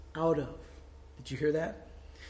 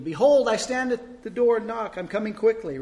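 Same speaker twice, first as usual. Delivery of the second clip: loud, higher proclamation; calling out; 6 semitones higher, 7 dB louder than usual. A narrator's reading. Preacher calling out the verse to God, loud and high. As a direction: declare it out loud to heaven. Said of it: "said it out loud, kind of waiting".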